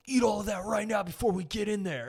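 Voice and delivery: high-pitched imitation of a pushy brother; urgent friend's brother. Friend's brother ordering him before they go in, high urgent voice. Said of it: "my friend's brother's like".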